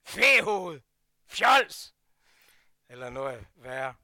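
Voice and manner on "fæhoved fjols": loud mock insults. Speaker in his old temper to the careless boy, loud insults. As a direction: bark the insults at him. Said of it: "så vil jeg sige".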